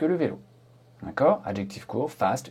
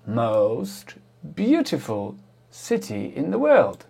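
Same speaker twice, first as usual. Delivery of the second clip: very slow, stretched English, word by word; slow model example; much slower than usual. Shown as a demonstration. Teacher giving the Paris example, slow and drawn out. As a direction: draw out "most" and "beautiful" for learners.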